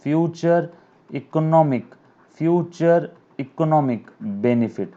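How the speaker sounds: every word stretched slowly; teacher reading out the asset definition slowly; slow dictation. Shown as a demonstration. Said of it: "teacher dictating definition slowly".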